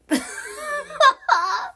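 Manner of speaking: high squeal of laughter; losing it completely; real squealing laugh. Real, not acted. Laughing at her own story, squealing. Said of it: "brings me so much joy now".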